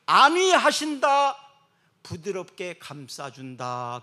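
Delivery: slow, tender definition; gentle preacher reading. Acted. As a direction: let the words wrap around them. Explaining word tenderly.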